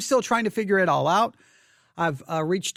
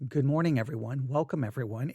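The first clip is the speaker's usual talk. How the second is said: calm greeting; 5 semitones lower, 5 dB quieter than usual. Real, not acted. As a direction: lower and softer, composed restart. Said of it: "let's do this again".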